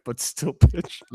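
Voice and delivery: slowing down and breaking into helpless laughter; reading aloud, losing it to laughter; can't read without laughing. Real, not acted. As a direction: crack up on the last words. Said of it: "can't read this without laughing".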